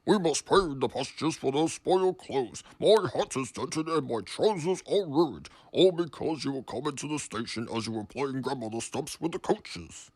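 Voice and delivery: loud, low scolding boss; cross. A narrator's reading. Sir Topham Hatt scolding.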